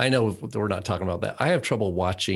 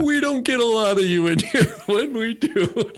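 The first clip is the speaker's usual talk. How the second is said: high, laughing through the words; laughing joke; 11 semitones higher, 3 dB louder than usual. Real, not acted. Cohost laughing at the messianic rabbi remark. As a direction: giggle as you say it.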